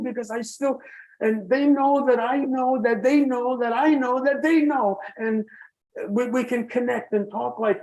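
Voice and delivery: high-pitched, heartfelt insistence; impassioned. Real, not acted. Survivor telling what pandemic nurses went through, impassioned.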